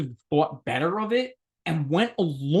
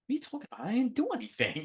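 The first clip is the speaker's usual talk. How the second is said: feigned innocence; 9 semitones higher, 3 dB quieter, much faster than usual. Retold or imitated.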